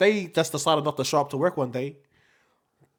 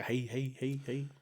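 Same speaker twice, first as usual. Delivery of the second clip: a quiet, lowered greeting; low voice; 4 semitones lower, 15 dB quieter, slower than usual. Shown as a demonstration. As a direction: voice pushed down low and soft. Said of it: "deeper voice than the bro".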